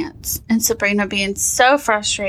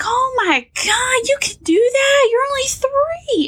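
amazed. Retold or imitated. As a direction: gush at the toddler's kick. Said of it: "I was like".

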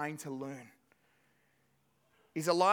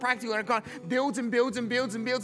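louder, fired-up preacher's delivery; preaching at full force; 7 semitones higher, 3 dB louder than usual. Acted. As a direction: build the sermon with energy and punch. Preaching worked up.

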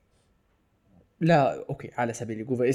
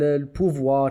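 ordinary talk, nothing marked

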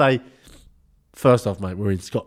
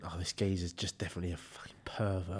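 whispered, much softer; whispered thought; 9 semitones lower, 17 dB quieter than usual. Pretend. Imagined private thought.

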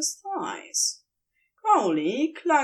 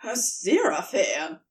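a lightly voiced startled yelp; mild yelp. A narrator's reading. Flustered demon protesting his lover's kiss, mild yelp. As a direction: flinch and yelp the name, understated. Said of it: "Crowley yelped".

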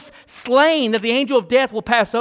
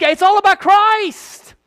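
slow, loud, much higher proclamation; preaching climax; 9 semitones higher, 5 dB louder, slower than usual. Acted. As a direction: shout the climax to the back pew. Climax of the sermon.

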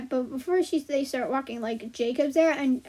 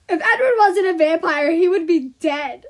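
loud, higher exclamation; emphatic aside; 4 semitones higher, 8 dB louder than usual. Real, not acted. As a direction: blurt it out loudly over the story. Her own loud aside on Edward's age.

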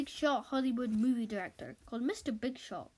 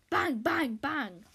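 loud, higher banged-out bangs; gavel bangs; 3 semitones higher, 5 dB louder, slower than usual. Acted. Robot judge banging the gavel, mock loud bangs. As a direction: hammer each bang like a gavel.

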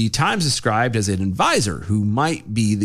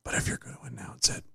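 much softer, hushed objection; questioning whisper; 9 dB quieter, faster than usual. Acted. Colleague challenging the mayor's secrecy, questioning whisper. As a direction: whisper back, playing along. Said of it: "why are you whispering?".

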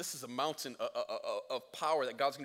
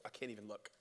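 dropped low and soft; hushed Moses; 7 semitones lower, 11 dB quieter, faster than usual. Retold or imitated. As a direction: mutter it, awed and hiding. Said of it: "he's like".

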